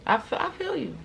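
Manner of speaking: high laughing resignation. Retold or imitated. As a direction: give in with a laugh. Resigned to God.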